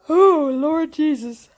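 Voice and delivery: high, loud, drawn-out weary exclamation; tuckered out. Real, not acted. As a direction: let exhaustion out in one long exclamation. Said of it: "I am officially tuckered out".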